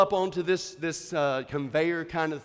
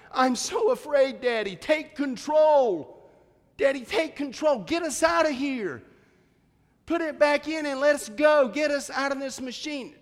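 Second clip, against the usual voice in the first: imitated panicked wailing; 10 semitones higher, 3 dB louder than usual. Scared daughter begging Daddy to take control, imitated wailing. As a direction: act out a child's frantic begging. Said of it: "She started wailing, screaming, crying".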